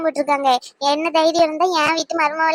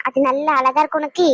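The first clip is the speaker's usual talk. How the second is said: usual speaking voice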